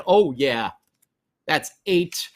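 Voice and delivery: ordinary delivery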